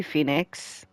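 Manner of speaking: soft, far-off call of the name; a lightly voiced ghostly sister; Mia's voice. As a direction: call him gently from somewhere distant. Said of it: "it sounds like Mia".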